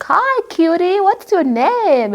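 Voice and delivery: high, slow coo for a pet; sweet talk to a dog. Retold or imitated. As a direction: coo at the dog like it's a baby. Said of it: "talk to them like a human being and be like".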